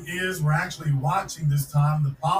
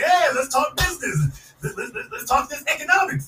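mock eager business pitch; 7 semitones higher than usual. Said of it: "They came to the door saying".

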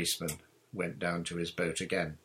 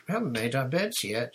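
mild, raised-pitch protest; 5 semitones higher, 5 dB louder than usual. A narrator's reading. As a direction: object a little indignantly, as a girl would. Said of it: "Susan protested".

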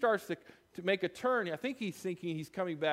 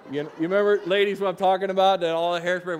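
loud teasing aside; 3 semitones higher, 11 dB louder, faster than usual. Real, not acted. Preacher teasing the ladies about eighties hairspray, loud and playful. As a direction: tease the ladies with a grin in the voice.

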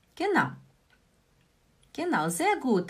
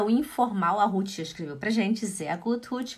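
ordinary talk

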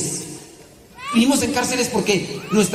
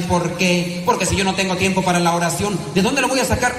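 ordinary talk

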